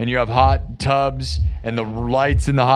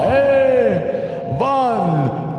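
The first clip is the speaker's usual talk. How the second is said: high, drawn-out puppet vampire counting; hammed-up vampire count; 9 semitones higher, much slower than usual. Vampire count counting goth babes, high hammed-up accent. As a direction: count grandly in a mock vampire voice. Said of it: "the count".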